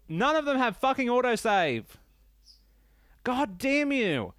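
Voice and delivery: high-pitched, frustrated outburst; exasperated rant. Real, not acted. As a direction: let the gamer frustration burst out, pitch climbing. Ranting about missing autosave.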